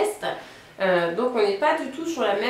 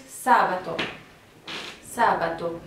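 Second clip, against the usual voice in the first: sabato with a long, accented SA; stress on first syllable; 3 semitones lower than usual. Shown as a demonstration. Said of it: "de la première syllabe".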